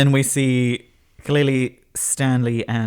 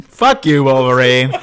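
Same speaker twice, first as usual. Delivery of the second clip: mock snarky retort; 2 semitones higher, 8 dB louder than usual. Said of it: "is what she's saying".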